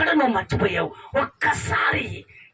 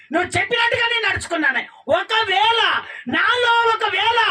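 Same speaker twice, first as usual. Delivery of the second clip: desperate plea; 9 semitones higher than usual. Acted. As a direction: beg God for mercy. Hagar's plea to God.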